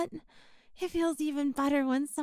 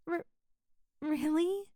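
normal talking voice

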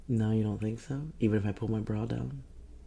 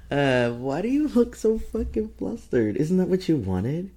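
high, loud teasing challenge; mock incredulous; 9 semitones higher, 7 dB louder than usual. Teasing a flustered partner, mock incredulous. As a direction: pitch it up loud, needling the flustered listener.

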